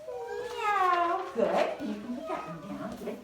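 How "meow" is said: high meow like a cat. Acted. Teacher showing the class the cat, with a high meow. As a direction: mew it like a kitten, high up. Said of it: "the cat says".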